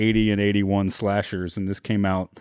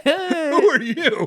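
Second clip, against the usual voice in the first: very high and slow, laughing through it; laughing drawn-out quote; 3 dB louder, much slower than usual. Retold or imitated. Retelling the principal's line while laughing, slow and high. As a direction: stretch it out while cracking up. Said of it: "repeats the quote laughing".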